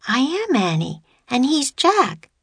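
put-on high-pitched little girl voice; young girl. A narrator's reading. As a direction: pipe up like an eager child. Narrator voices young Annie.

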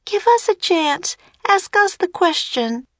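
high-pitched pleading shout. A narrator's reading. Annie begging the serpent for a chance, shouting. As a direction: plead urgently in a high child's voice. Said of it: "Annie shouted at the sea serpent".

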